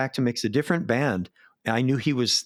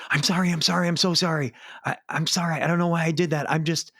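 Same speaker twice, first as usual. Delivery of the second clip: retold yell; 6 semitones higher than usual. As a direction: yell the apology over a jamming band. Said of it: "yelling it over the".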